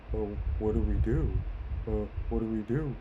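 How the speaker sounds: put-on parental fretting; mock helpless parents. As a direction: imitate parents at a loss. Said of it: "they look at each other like".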